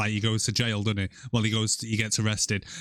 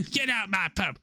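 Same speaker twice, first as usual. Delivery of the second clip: higher-pitched mock Cockney accent; EastEnders Cockney; 8 semitones higher than usual. Copying the co-host's EastEnders joke, mock Cockney. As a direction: echo a soap landlady in put-on Cockney. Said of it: "Watching too much EastEnders".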